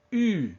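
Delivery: U as ee through rounded lips; high-pitched isolated French U. Shown as a demonstration. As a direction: model U with tongue forward, lips pursed. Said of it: "is the French U sound".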